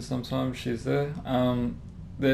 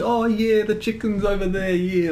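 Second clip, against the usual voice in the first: mock Chinese accent; 7 semitones higher, 3 dB louder than usual. Covering up to the coworker, mock Chinese accent. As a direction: keep up the imitated Chinese accent. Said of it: "trying to play it off like".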